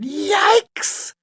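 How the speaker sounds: very high, loud yelp; sudden high-pitched shriek; startled yell. A narrator's reading. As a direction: yelp it out in alarm. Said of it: "turned around and yelled".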